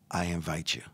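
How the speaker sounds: low, hushed solemn invitation; Jesus inviting. Retold or imitated. Jesus inviting Andrew, low and solemn. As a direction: sink the voice low and quiet, grave. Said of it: "So big".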